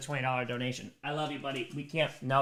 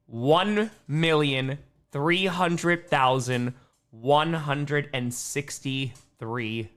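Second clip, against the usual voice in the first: each number spelled out slowly; slow proud announcement; 4 dB louder, much slower than usual. Real, not acted. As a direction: read the total out slowly and proudly. Announces fundraising total.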